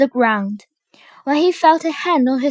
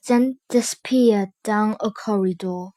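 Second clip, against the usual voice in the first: usual speaking voice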